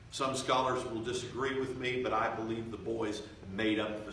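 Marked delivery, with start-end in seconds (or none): none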